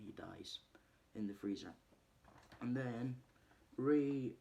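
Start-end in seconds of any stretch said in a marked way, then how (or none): 0.1-0.6 whisper. Real, not acted. Telling how Matthew dies in the freezer, whisper. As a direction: hush it, a voiceless whisper. Dramatic reveal of death.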